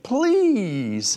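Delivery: long, high, hammed-up plea; drawn-out pleading. Young man begs his date one last time, drawn-out pleading. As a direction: stretch it out and beg.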